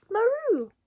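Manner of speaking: high cat maroo; cat hiss. A narrator's reading. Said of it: "who hissed".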